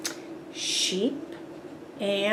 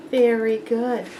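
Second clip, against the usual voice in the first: ordinary delivery, nothing marked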